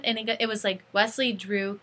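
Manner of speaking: ordinary talk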